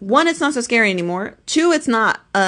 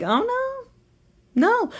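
much higher, squeaky protest; slower than usual. Retold or imitated. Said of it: "a part of me that's like".

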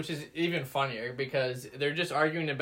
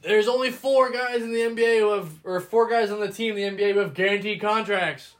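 raised, higher mock rant; Brandon Marshall yelling; 5 semitones higher, 5 dB louder than usual. Co-host re-enacting the clip about guaranteed contracts, mock yelling. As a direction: imitate an argument at a loud, high pitch. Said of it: "what he's yelling about".

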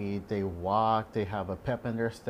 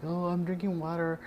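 higher, naive patient voice; lightly mocking patient impression; 8 semitones higher than usual. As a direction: mimic a clueless patient. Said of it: "And like".

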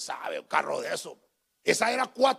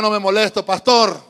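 loud repeat-after-me; call-and-response line; 10 dB louder than usual. Shown as a demonstration. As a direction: lead the church in the line. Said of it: "Diga conmigo".

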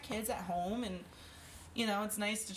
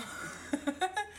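high laugh; 11 semitones higher than usual. Real, not acted. Laugh about splatter.

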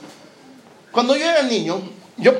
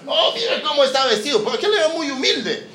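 high, teasing, laughing; mocking courtier; 6 semitones higher than usual. Courtiers mock Elías' clothes.

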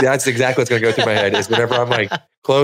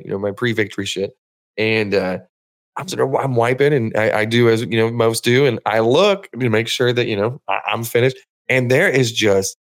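ordinary delivery

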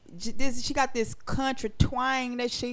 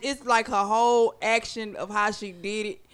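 ordinary talk, nothing marked

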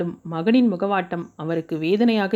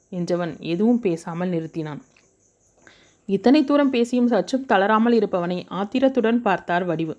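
usual speaking voice

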